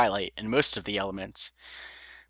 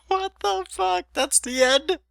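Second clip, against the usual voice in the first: laughing; slower than usual. Real, not acted. Reader laughing in disbelief.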